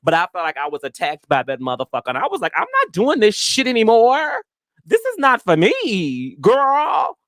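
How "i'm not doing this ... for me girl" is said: high, indignant disbelief; high exasperated retort; exasperated outburst. Retold or imitated. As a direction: recoil in exasperation, pitched up. Retold outburst after rough night.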